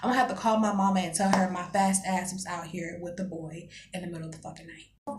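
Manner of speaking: normal talking voice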